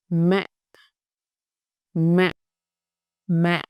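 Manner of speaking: "Mẹ" cut very short and dropped low with a sudden stop; short low nặng tone. Shown as a demonstration. Teacher showing the dark nặng tone, "Mẹ" short and low. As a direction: drop the voice suddenly on "Mẹ". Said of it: "very short and very low".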